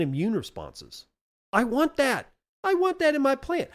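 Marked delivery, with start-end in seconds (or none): 1.5-3.7 high, excited outburst; eager wanting. Acted. Gardening teacher wanting the fungus in the plant, eager. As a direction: pitch jumps up, insistent and keen.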